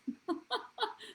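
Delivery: high bright burst of laughter; real laugh. Real, not acted. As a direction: laugh it off, amused. Laughs about free dress.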